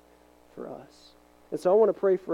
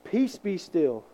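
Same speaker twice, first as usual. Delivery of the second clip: calm command; 4 dB louder, slower than usual. Retold or imitated. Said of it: "and he says".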